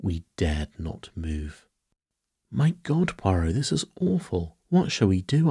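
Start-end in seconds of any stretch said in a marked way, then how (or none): 2.5-5.5 high-pitched appeal in dismay; mildly panicked, pitch leaping up; dismayed cry. A narrator's reading. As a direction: cry out helplessly to a friend. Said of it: "I cried".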